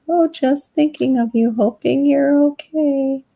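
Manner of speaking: a lightly sugary imitation of the sister; mocking sister. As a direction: sing it out in fake sympathy. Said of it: "It's like".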